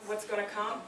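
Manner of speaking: usual speaking voice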